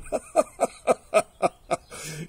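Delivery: loud staccato lion belly laugh; roaring lion laughter. Acted. Lion laughing at the mouse's offer, uproarious. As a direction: roar with mocking laughter. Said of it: "The lion roared with laughter".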